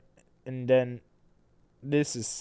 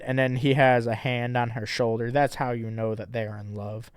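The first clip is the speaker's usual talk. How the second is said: ordinary talk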